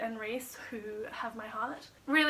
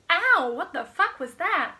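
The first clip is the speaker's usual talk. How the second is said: loud, high, drawn-out yelp of alarm; startled outburst; 6 semitones higher, 11 dB louder, slower than usual. Real, not acted. Vlogger jolted by something mid-recording, loud startled cry.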